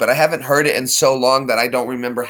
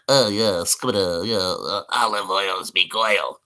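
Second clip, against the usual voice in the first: low muttering cartoon sailor; slow, garbled sailor mumble; Popeye; 4 semitones lower, 3 dB quieter, slower than usual. Shown as a demonstration. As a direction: mangle the words like a salty cartoon sailor. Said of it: "Popeye the Sailor Man?".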